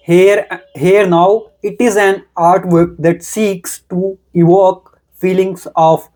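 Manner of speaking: careful, deliberate reading voice; reading aloud. Acted. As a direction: read the passage clearly and steadily. Reads passage aloud after removing pen.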